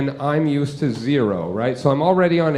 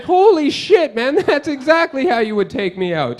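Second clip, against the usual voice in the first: high-pitched, astonished outburst; mock alarm; 10 semitones higher, 3 dB louder than usual. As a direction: blurt it out in overplayed shock. Acted shock at the kid's threat.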